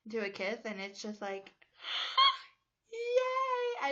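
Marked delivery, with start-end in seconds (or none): 1.8-2.4 a sharp, very high, loud gasp of delight; excited gasp. Retold or imitated. Arnold pulls Helga into a kiss. 2.9-3.9 a high, loud squealed yay; cheering. Retold or imitated. Cheering for the kiss.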